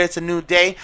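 ordinary talk

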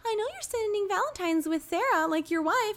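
mistress's put-on voice. Mistress talking to the married sheriff, high mocking imitation.

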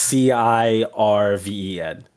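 slow letter-by-letter spelling. Shown as a demonstration. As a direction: spell each letter out slowly. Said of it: "Spelled like this".

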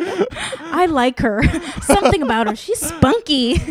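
high, loud, laughing parody; hammed-up, laughing endorsement; mock admirer. Mock defense of her, laughing.

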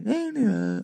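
half-hearted shrug of a reply, put on; imitating indifferent Brittany. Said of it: "like how she was with her sister".

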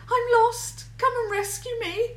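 high, loud pleading call; calling for help. A narrator's reading. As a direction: shout for rescue across the hedges. Lost girl calling for rescue.

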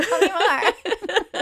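slow, playful, high teasing drawl. Pretend. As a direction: tease her to keep bragging. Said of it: "Go on".

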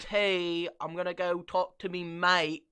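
loud, broad mock British lad; exaggerated British accent, loud. Podcaster mocks talk of mates, mock British accent. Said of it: "They talk like that".

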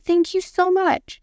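high, slow, strained thank you; mortified thank you. Retold or imitated. Embarrassed woman thanking her friend's mom for the warning, high and slow.

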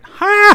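high, loud single "ha"; mock laugh. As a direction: punch out one loud, high burst of laughter. Joking about the pilot pickup.

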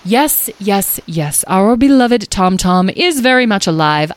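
loud, emphatic, fed-up assurance; theatrical end-of-patience announcement; exasperated. Acted. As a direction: spell it out forcefully, slowing at the end. Exasperated answer to repeated question.

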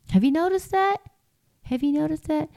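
high, soft, repeated prompt; pointed questioning. Real, not acted. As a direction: ask it high and small, nudging the listener.

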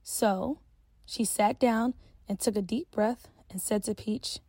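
ordinary delivery

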